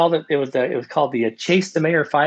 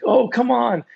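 higher, whiny retold protest; exasperated whine; 10 semitones higher, slower than usual. Runner complains to coach about one more stretch, mock exasperated whine. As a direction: imitate her fed-up moan at the coach.

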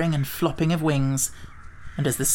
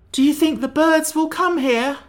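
much higher, louder anxious question; distressed Pumpkinhead; 12 semitones higher, 5 dB louder than usual. A narrator's reading. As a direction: ask in frightened distress. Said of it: "much distressed".

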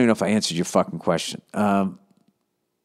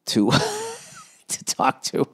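laughing; slower than usual. Real, not acted. Laughing at his own admission.

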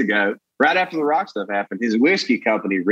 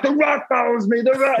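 high-pitched, frantic retold calling out; mock drunken boasting, pitched way up; excited calling out; 11 semitones higher than usual. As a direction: call it out like you're losing your mind. Shouting from back of club.

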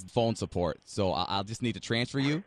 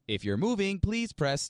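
phone menu voice; 8 semitones higher, slower than usual. Acted.

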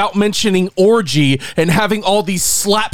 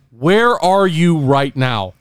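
slowed, deliberate, calm; a hint of a steady hero; slow serious question; 2 semitones lower, slower than usual. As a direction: ask it slowly, like a protector. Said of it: "I'm like".

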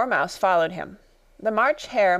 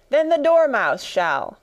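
higher, drawn-out call; crying out; 7 semitones higher, 4 dB louder than usual. A narrator's reading. Hatter and March Hare both volunteer the Dormouse, crying out. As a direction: call it out slowly and high. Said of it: "they both cried".

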